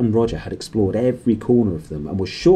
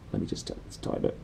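hushed aside; 12 dB quieter, faster than usual. Real, not acted. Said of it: "reader aside, hushed".

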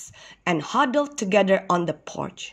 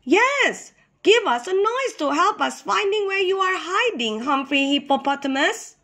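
little hippo voice; 9 semitones higher than usual. A narrator's reading.

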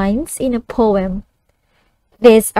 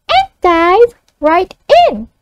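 put-on higher child voice echoing back; 5 semitones higher than usual. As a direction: echo it like a young pupil. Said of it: "the child reads it back".

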